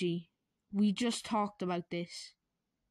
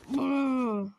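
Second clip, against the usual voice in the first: seizure noise; 4 semitones higher, 6 dB louder than usual. Acted. Luigi seizing again.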